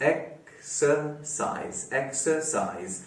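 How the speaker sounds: Exercise in three slow, separated syllables, softly. Shown as a demonstration. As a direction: pause inside exercise after each syllable. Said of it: "तीन syllables वाला word". (Exercise को तीन हिस्सों में रुक-रुक कर)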